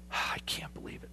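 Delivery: a sigh, then a whispered "I can't believe it"; whispered despair. Retold or imitated. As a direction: give up under your breath.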